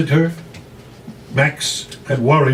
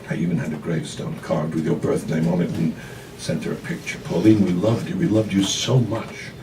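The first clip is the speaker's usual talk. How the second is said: lower, softer, tender confession; pleading mother; 6 semitones lower, 7 dB quieter than usual. Acted. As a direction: reach out to her, aching.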